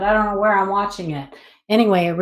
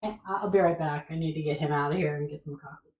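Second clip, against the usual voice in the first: normal talking voice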